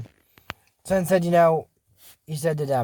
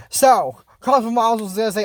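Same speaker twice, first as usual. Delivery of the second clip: normal talking voice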